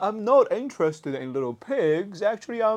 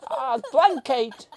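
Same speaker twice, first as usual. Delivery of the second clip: very high, loud, squealing panic; panicked toy voice; 13 dB louder than usual. Pretend. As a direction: shriek to get the blanket off. Toy begging blanket off.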